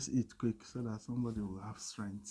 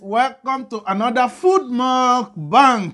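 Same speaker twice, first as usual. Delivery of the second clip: host intro; 11 semitones higher, 15 dB louder, slower than usual. Acted. Greeting viewers on camera.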